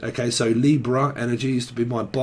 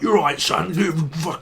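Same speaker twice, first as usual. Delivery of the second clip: raised-pitch impression of a hearty boss; old boss greeting; 5 semitones higher than usual. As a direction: imitate a boss grabbing his lad. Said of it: "He gets hold of me, he's like".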